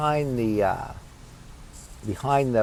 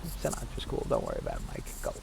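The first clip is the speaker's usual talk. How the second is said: hushed, laid-back reassurance; cool father; 11 dB quieter, faster than usual. Retold or imitated. Father quietly reassuring his son, cool hushed voice. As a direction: drop to a very soft, low murmur. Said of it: "he was cool. He was like".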